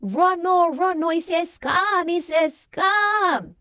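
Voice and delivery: high, loud warning yell, drawn out at the end; desperate shout. Retold or imitated. Michael warning his brother to run, a high loud yell. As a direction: scream the warning to get him out. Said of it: "He's shouting his brother's name".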